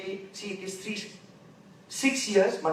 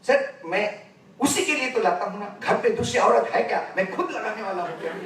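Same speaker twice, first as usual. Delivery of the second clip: exasperated husband; 4 semitones higher, 7 dB louder, much faster than usual. Retold or imitated. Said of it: "then he said".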